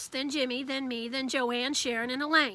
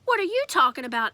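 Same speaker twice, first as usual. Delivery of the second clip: a touch louder, teasing echo; mocking mimic; 3 semitones higher, 6 dB louder than usual. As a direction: throw his words back at him. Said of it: "Alex mimicked".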